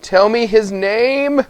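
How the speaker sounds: Gran insisting. A narrator's reading. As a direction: draw it out as an anxious grandmother. Gran demands the baby's name.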